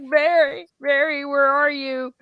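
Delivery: mildly voiced worried call for a lost friend; calling out for Mary. Pippin running around looking for Mary, calling out. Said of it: "He's running around going".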